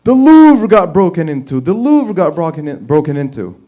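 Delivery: loud, urgent mock frantic wife; wife bursting in, mock frantic. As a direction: blurt it out like a panicked wife. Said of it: "she's all frantic".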